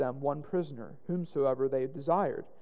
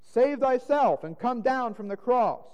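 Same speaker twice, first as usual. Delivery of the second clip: raised, louder jeer from passers-by; railing taunt; 6 semitones higher, 4 dB louder than usual. A narrator's reading. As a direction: rail at him, high and emphatic. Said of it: "railed on him".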